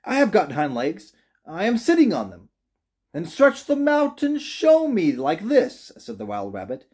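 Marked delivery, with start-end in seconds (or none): 0.0-2.5 insistent little rabbit, pitched up; crying out. A narrator's reading. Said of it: "cried the little rabbit". 3.1-5.9 very high, slow, louder dare; taunting challenge. A narrator's reading. Wild rabbit challenging the toy rabbit.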